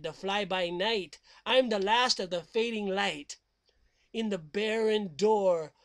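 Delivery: slow, ominous recital; spooky poem reading. Acted. Host reciting a horror poem to listeners, ominous. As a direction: read it like a creepy campfire poem.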